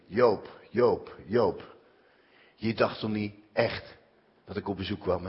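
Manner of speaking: low, soft, taunting read; cold mocking letter. Reading out the hurtful letter he got, low and taunting.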